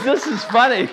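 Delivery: high, loud, laughing reply; God laughing. Pretend. God answering his prayer for help, laughing. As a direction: voice God enjoying himself. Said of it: "He says".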